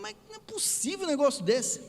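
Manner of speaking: high-pitched indignant outburst; retold anger. Retelling his anger at the driver, high indignant protest. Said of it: "E eu bravo com o rapaz".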